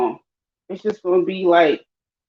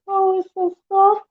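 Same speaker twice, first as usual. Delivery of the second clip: high, put-on soft little voice; imitated soft voice; 14 semitones higher than usual. Teasing a friend about her voice, imitated soft and high. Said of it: "your, your voice is like".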